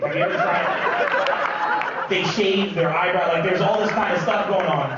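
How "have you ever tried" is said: very high, slow squeal; absurdly high stretched voice; mock squeal. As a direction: squeak it out slowly, laughing.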